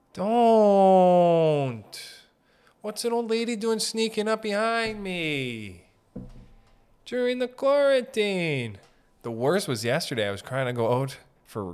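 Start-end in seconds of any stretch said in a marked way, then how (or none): 0.1-8.8 high, slow exasperated whine; incredulous rant. Acted. Ranting about old people sneaking around, high and drawn out. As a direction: stretch it out, pitched up and outraged.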